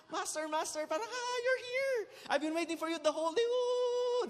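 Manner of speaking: high, gushing excited dog voice. Acted. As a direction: squeal it like a dog greeting its master.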